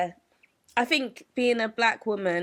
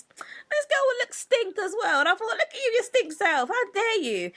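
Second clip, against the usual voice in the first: mock high snooty voice; 12 semitones higher than usual. Mocking the girl who took pictures, in a high put-on voice.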